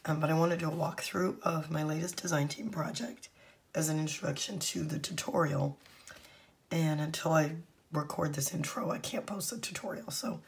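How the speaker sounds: hoarse, half-whispered voice; talking through a lost voice. Real, not acted. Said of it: "sorry about my foghorn voice".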